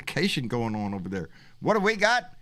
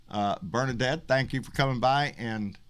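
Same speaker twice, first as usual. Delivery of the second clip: ordinary delivery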